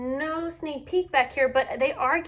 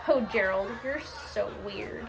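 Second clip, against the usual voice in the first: sneering; 5 semitones lower than usual. Acted. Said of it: "all the animals sneered".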